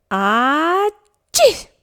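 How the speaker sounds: loud, high explosive bear sneeze; big sneeze. A narrator's reading. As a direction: burst out in a huge sneeze. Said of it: "El gran oso ha estornudado".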